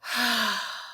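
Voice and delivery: long, slow release of breath; a big audible sigh out; deep exhaling sigh. Shown as a demonstration. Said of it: "take a deep breath in and be like".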